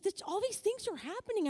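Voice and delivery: high-pitched, swooping excitement; excited friend. Retold or imitated. Friend telling of her blessings, high and excited. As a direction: imitate a friend thrilled and amazed. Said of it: "she was so excited".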